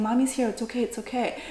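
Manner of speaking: soft, hushed reassurance; soothing mother. Retold or imitated. Mother soothing injured baby, retold.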